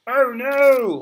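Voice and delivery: sudden high, loud yelp of dismay; real surprise. Real, not acted. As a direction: blurt it out as something falls. Teacher knocks diffuser over.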